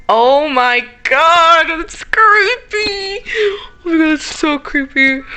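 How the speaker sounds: high, loud, slow frightened wail; scared horror game streamer. Real, not acted. Sees the creepy face.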